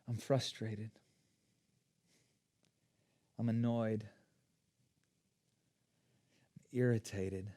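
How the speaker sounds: low, soft, isolated statements; name each feeling quietly, leaving long pauses; hushed, subdued confession; quiet admission. Shown as a demonstration. Models saying emotional words out loud.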